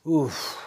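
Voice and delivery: big heavy exhale; dramatic sigh. Real, not acted. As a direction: let out a loud weary sigh. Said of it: "that was a pretty big sigh here".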